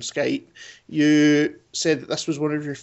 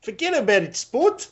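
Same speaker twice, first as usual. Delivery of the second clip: higher, mock New York accent; bad New York accent; 9 semitones higher than usual. Host playing up the catchphrase for laughs, bad New York accent. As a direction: put on a hammy, unconvincing New York accent. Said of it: "doing a really bad accent".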